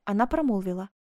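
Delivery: plain reading voice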